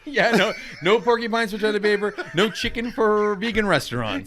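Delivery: laughing loudly through the list. Real, not acted. Cohost joking about absurd mascots, laughing loudly.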